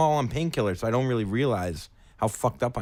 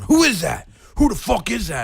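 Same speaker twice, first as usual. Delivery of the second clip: higher, louder, threatening challenge in the dark; alarmed angry yell; 9 semitones higher, 7 dB louder than usual. Retold or imitated. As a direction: demand who's there, ready to fight. Player hears intruder in dark.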